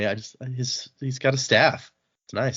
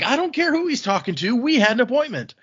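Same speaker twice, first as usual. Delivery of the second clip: mild mock yell; 11 semitones higher than usual. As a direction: act out berating a servant. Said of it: "he's yelling at the servant".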